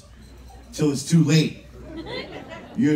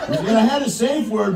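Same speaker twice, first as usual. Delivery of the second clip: normal talking voice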